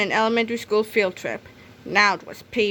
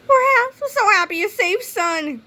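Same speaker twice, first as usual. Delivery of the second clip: very high, loud relieved mom; relieved mother's cry; 10 semitones higher, 3 dB louder than usual. A narrator's reading. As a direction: gush over a safe son as a mother. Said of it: "cried Missus Needley".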